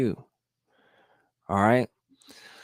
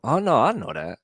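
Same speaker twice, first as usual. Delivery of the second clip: higher, firm Oh no I know that; certain I know that; 5 semitones higher than usual. Shown as a demonstration. A vlogger showing the confident answer against doubt, a bit higher. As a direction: correct yourself with sure conviction.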